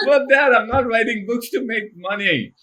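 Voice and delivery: amused protest to the father; laughing protest. Retold or imitated.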